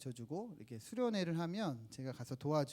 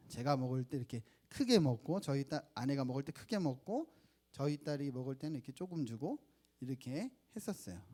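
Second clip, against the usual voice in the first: normal talking voice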